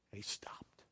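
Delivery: hushed whisper for the dramatic moment. A narrator's reading. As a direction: breathe the words, barely voiced. Dramatic pause at Jesus stopping.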